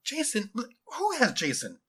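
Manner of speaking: high, slow, stunned question; worried girl's high voice; alarmed. Acted. Girl alarmed at friend's kidnapping.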